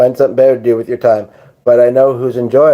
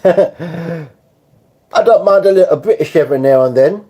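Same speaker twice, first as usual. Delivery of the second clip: slow, higher-pitched put-on British; mock British accent; 4 semitones higher, slower than usual. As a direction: try on a British accent for fun. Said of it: "I'm not great at accents".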